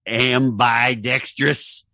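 very slow, deliberate word; drawn out. Acted. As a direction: stretch the word for a joke.

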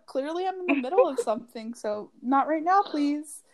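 high, strained-polite brush-off; acted teacherly exasperation. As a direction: fend off an interrupting child, politely. Teacher fending off interrupting child.

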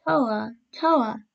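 put-on mildly plaintive little boys' call; understated plaintive cry. A narrator's reading. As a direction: call out for mother, lightly plaintive. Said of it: "calling out plaintively".

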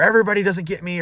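high-pitched imitation of an excuse-maker; mock complaint. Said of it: "they have some complaint about something".

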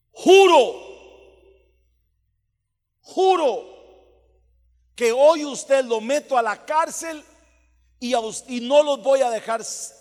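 imitated lawyer roaring an oath; shouted threat. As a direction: thunder the retold oath, high and loud.

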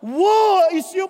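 slow, heavy, loud pronouncement of doom; prophet's woe. Retold or imitated. Preacher voicing a prophet cursing the people, slow and loud. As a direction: intone it like an old prophet. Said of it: "sinasabi ng mga propeta sa mga tao".

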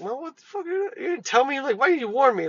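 high-pitched mock indignation; indignant side-eye complaint. Worker giving Thompson the side eye, indignant. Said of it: "giving Thompson the side eye".

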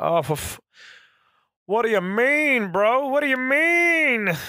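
high, slow, incredulous "what do you mean, bro"; exasperated disbelief. Retold or imitated. Listener hears lockdown extended again, high slow exasperated disbelief. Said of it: "And you're like".

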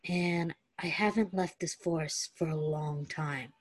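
soft and low, a touch of roughness; low rough voice. Acted. Stiles quietly telling Derek of his isolation, low and rough. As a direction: say it low like a lonely confession. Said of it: "In a low, rough voice".

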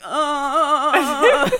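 groaning at the anesthesiologist's questions; pained groan into laugh. Retold or imitated. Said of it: "'cause I was like".

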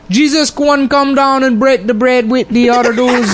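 high-pitched, hammed-up Gullah storytelling; exaggerated Gullah island accent. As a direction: recite scripture in a high mock Gullah accent. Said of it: "translated the New Testament into Gullah".

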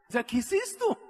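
high-pitched bewildered question; baffled. Retold or imitated. Newcomer puzzles over the veteran's talk, baffled. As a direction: ask it high, as if totally lost.

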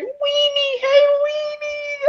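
put-on very high, sing-out baby talk; cutesy pet-name voice. Guest mimicking woman greeting her dog, squeaky baby talk. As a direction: call a little dog in a squeaky coo. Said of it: "cute little pet names".

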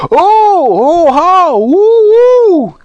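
long, very high wailing yells; loud hooting howls of alarm; yelling. A narrator's reading. Saucepan man out of sight above, yelling wildly. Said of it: "he began to yell".

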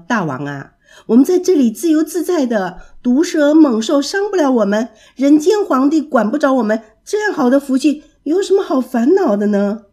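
usual speaking voice

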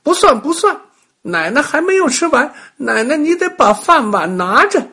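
put-on high, loud child's protest; little kid hollering foul; loud clamouring. A narrator's reading. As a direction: yell objections as an upset small boy. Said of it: "峰峰大声地嚷嚷".

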